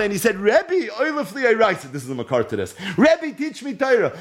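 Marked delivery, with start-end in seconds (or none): 0.4-1.9 high, pleading Rebbe in a tearful plea; a retold tearful plea, voice lifted high; pleading cry. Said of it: "so Rabbi Akiva started to cry". 3.0-4.1 high, pleading teach me Torah; retold weeping request, pitched high; tearful plea. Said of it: "He starts crying".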